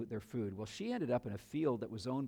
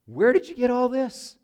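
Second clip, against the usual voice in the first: high, loud astonished voice; stunned mother-in-law asking; amazed question; 11 semitones higher, 13 dB louder than usual. Retold or imitated. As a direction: Naomi gasps at Ruth's haul of barley. Naomi amazed at the grain.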